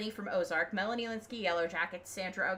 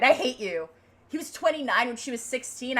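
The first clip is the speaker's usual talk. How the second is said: mock outrage; 4 semitones higher, 7 dB louder than usual. Real, not acted. Ranting about Charles.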